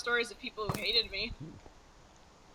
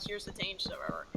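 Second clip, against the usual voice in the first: fast, softer whispered mock; whispered aside; 5 semitones lower, 6 dB quieter, faster than usual. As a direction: rush it under your breath. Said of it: "I was just gonna be like".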